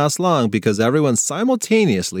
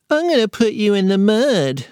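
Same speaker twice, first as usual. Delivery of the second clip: put-on much higher, childish little-boy voice; toddler threat voice; 9 semitones higher than usual. A narrator's reading. Little brother threatening his sister in a high toddler voice. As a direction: pipe it up like a tiny tot.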